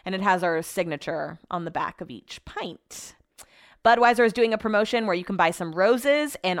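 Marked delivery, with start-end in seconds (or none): none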